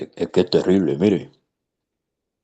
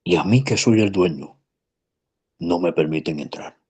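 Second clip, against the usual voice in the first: sweet voice. Retold or imitated. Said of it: "con voz dulce, le dijo".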